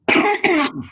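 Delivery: sharp high coughing fit; choking cough. Real, not acted. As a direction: cough hard to clear the throat. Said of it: "I swallowed the wrong".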